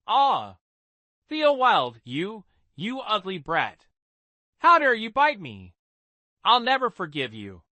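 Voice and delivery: high, loud outraged yelling; angry shouting child. Acted. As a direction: yell at the brat who bit you. Bitten boy screams at Theo.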